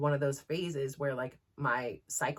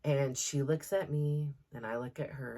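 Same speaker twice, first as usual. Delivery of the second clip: normal talking voice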